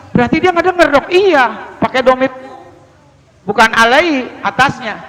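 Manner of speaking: ordinary talk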